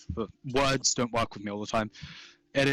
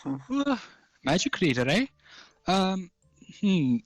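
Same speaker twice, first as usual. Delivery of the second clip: gnome shopkeeper; 7 semitones higher than usual. Acted. Gnome pondering.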